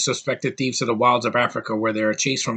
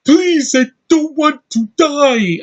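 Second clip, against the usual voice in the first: high, loud, drawn-out hammy pleading; mock overacted wail of a plea; bad actor's plea; 7 dB louder, much slower than usual. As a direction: beg for your life like a terrible actor, slowly. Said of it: "this is how he acts".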